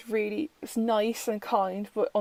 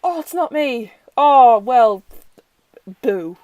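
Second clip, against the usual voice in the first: mock sulk; 10 dB louder, slower than usual. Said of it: "rather than going".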